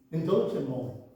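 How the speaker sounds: ordinary talk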